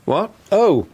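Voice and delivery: sudden high startled oh; jolted out of his thoughts. Acted. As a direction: caught off guard. Father suddenly startled by daughter.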